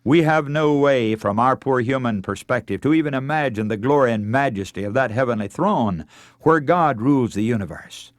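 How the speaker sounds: ordinary talk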